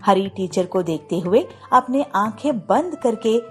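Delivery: ordinary delivery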